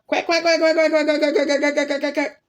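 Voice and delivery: high, rapid run of quacks; duck quacking. Retold or imitated. Grabbed duck protesting in the child's arms, frantic quacking.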